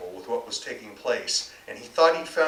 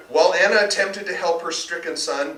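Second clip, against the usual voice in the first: ordinary delivery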